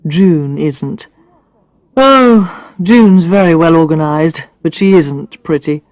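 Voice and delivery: a hint of a blunt man, teasing; Brad's voice. Brad teasing Alex about her looks, lightly voiced man.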